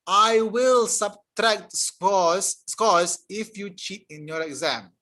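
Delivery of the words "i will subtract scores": "will" drawn out slow and loud; stress on will. Shown as a demonstration. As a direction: lean hard on "will", slowly. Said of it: "Future tense".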